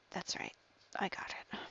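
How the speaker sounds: breathy whisper to herself; a private whispered "got it"; whispered aside. Real, not acted. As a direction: mutter it under your breath, just for yourself. Muttering to herself while working.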